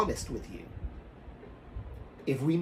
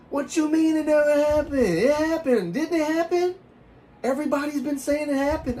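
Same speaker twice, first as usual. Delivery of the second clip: high, loud imitation of disbelief; mock-astonished guy, voice pitched way up; incredulous schoolmate; 13 semitones higher, 5 dB louder than usual. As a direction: protest like you can't believe it. Schoolmate acts disbelieving at denial.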